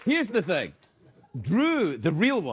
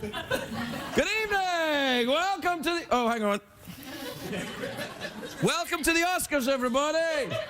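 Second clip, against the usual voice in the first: mock Oscars host; 8 semitones higher than usual. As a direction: announce like a pompous awards host. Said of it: "Let's just give it a try".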